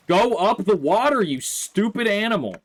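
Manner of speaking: high, loud exasperated yell; yelling at horse. Real, not acted. Player yelling at the horse that won't climb, exasperated shout. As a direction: scream it at the stubborn animal.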